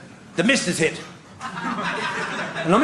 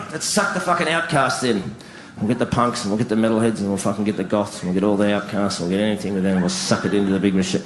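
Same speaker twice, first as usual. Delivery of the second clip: very low monotone list; scheming machine; 13 semitones lower than usual. Acted. Media bosses plotting to suck in the outcasts, scheming monotone. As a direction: reel it off low and level like a sinister plan.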